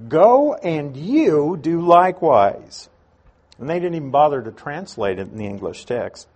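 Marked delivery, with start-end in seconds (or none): 0.1-2.9 slow, deliberate command stressing "you"; emphatic YOU. Shown as a demonstration. Preacher repeating Jesus' literal command to the lawyer, emphatic "you". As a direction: draw it out and press firmly on "you". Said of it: "it's a very emphatic you".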